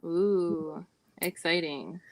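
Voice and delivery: ordinary talk, nothing marked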